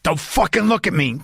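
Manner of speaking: cat as Dennis Hopper. Retold or imitated. Voicing his cat snarling at him while it humps a blanket. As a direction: snarl it like Hopper in Blue Velvet. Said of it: "That's how he says it".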